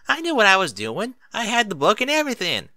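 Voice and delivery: put-on high, loud child insisting; sulky kid standing her ground; lightly voiced defensive child; defensive filly. A narrator's reading. Applebloom defending herself.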